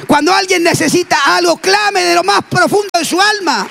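high, shouted preaching; preacher exhorting the congregation at full force; fervent preaching. Acted. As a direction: urge the church to cry out to God.

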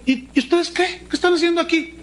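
high confronting challenge; John the Baptist. Acted. John the Baptist confronting the Pharisees, high challenge. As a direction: call them out sharply. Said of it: "y los mira".

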